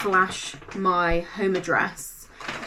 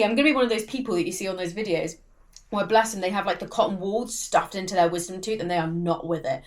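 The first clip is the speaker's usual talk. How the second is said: ordinary delivery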